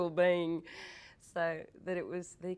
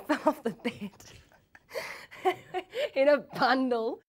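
laughing; 9 semitones higher, 6 dB louder than usual. Real, not acted. Laughing at memory.

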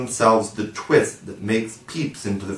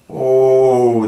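a louder, higher mock moan; 3 semitones higher, 7 dB louder than usual. A narrator's reading. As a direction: groan in pretend sorrow. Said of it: "they all moaned".

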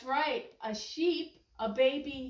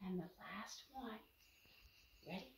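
hushed aside; whisper; 4 semitones lower, 19 dB quieter than usual. Real, not acted. Grandma whispering to her granddaughter, hushed. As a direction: whisper it so the others can't hear. Aside to child, hidden answer.

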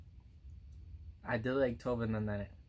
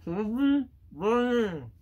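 garbled through a packed mouth; barely able to speak, mouth crammed; cheeks stuffed full; 6 semitones higher, 6 dB quieter, slower than usual. Real, not acted. Mouth packed, words barely come out.

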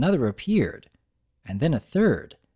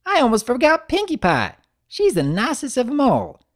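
high, eager little filly; a young filly remembering a friend; excited filly; 11 semitones higher, 4 dB louder than usual. Acted. Apple Bloom adding Pinkie Pie, high and eager filly voice.

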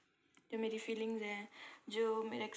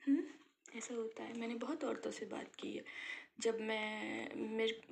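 normal talking voice

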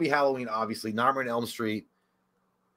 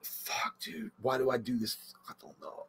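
frustrated whisper to himself; 12 dB quieter, slower than usual. Real, not acted. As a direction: mutter it in a breathy whisper. Frustrated, unable to choose.

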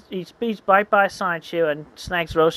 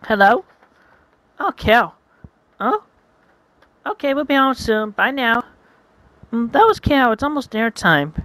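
put-on higher, louder girlish voice; a sweet girl answering her phone; 8 semitones higher, 5 dB louder than usual.

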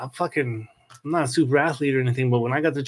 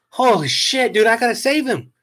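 alarmed; 7 semitones higher, 4 dB louder than usual. Retold or imitated. Dog sinks, owner panics.

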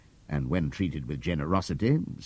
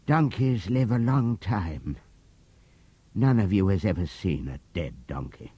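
a hint of a weary old donkey, unhurried; old donkey Benjamin. Benjamin the donkey answers cryptically.

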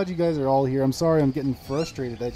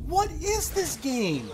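high, slow, falling wail; exasperated disbelief; 12 semitones higher, slower than usual. Real, not acted. Player beaten by the game, exasperated disbelief. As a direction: drag it out in despairing protest.

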